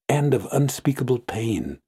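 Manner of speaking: plain reading voice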